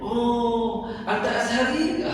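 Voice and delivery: lightly voiced surprised local, rising on "Azhari"; a happy local greeting a student. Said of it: "Senang dia".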